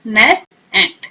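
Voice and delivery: "नर, एंट" said slowly and apart; slow, syllable by syllable. Shown as a demonstration. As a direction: draw out each syllable. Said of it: "pronounce करें आईटी".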